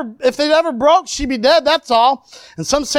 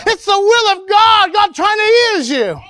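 high, drawn-out preacher's cry; fervent preaching; 9 semitones higher, slower than usual. Real, not acted. Preacher urging the burdened congregation, fervent preaching. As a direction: proclaim it to the congregation with force.

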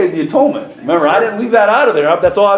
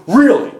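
"really" set apart, punched emphatically; stress on "really"; 3 semitones higher than usual. Real, not acted. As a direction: isolate and drive home "really". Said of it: "I want to emphasize that word".

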